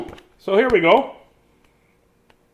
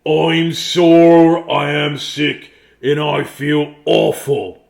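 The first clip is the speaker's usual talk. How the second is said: louder, slow moaning giant; sick giant; 6 dB louder, much slower than usual. Acted. Giant waking with a headache, slow and loud. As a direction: groan it out like a sore giant. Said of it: "he said".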